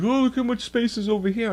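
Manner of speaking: high, sing-song mock of the neighbor. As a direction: sneer at his clueless remark. Mocking the neighbor's words.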